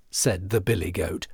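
plain reading voice

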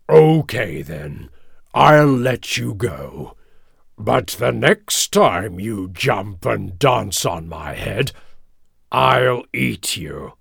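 slow, growly lion voice; deliberate lion warning, stretched words; warning King Lion. A narrator's reading. Lion letting the mouse go with a threat, growly warning. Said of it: "King Lion looked at the little mouse and said".

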